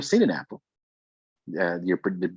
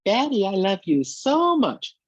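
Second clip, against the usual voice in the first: put-on much higher, slow, sweet little-boy voice; son's small high voice; 14 semitones higher, 5 dB louder, slower than usual. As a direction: imitate a small child sweetly saying he loves dad. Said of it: "his words of".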